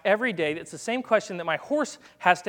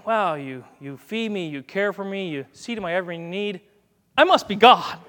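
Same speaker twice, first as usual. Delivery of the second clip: self-important cat. Acted. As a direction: start modestly, burst out on the self-crowning last line. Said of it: "What's cat theology?".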